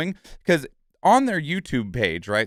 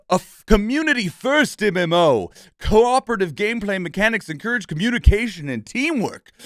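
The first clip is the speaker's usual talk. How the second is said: ad read; 2 semitones higher than usual. Acted. Streamer reading the game's website aloud as a mock ad. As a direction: read it like a glossy sales pitch, tongue in cheek.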